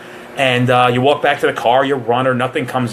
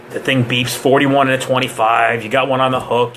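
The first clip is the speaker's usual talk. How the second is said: usual speaking voice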